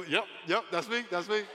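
high, loud, quick-fire yeses; gleeful confirmation. Retold or imitated. Pastor answering a doubting stranger, gleeful. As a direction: own it with loud delight.

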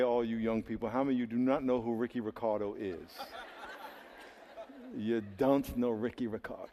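ordinary talk, nothing marked

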